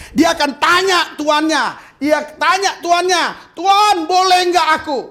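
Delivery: high, loud preaching build; sermon climax; rising preaching. Acted. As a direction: lift it to a preaching peak. Preaching peak, servant asking.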